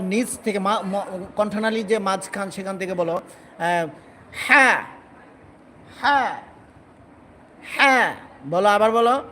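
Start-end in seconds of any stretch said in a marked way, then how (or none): 4.3-8.1 হ্যাঁ pushed out loud and firm from the throat; louder clipped হ্যাঁ, falling; forceful throat letter. Shown as a demonstration. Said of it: "শক্ত করে বলো".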